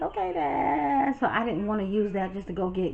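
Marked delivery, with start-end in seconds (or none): 0.0-1.2 slow, high reluctant okay. Retold or imitated. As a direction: drag out a grudging okay, Dad. Reluctant reply to dad.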